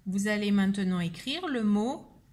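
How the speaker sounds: normal talking voice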